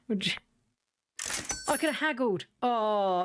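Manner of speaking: ordinary talk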